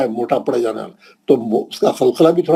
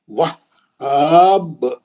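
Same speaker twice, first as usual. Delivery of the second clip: slow, drawn-out recitation with a bouncing qalqala at the end; tajweed recitation, strong qalqala on the final letter; Quran recitation; much slower than usual. Shown as a demonstration. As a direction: recite it slowly and let the last letter echo. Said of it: "हाब को दो बार पढ़ना है".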